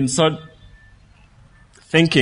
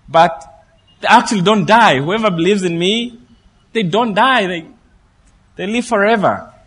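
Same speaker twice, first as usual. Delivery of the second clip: fervent preaching; 5 semitones higher, 3 dB louder than usual. Acted. Preaching Jesus' promise, rising.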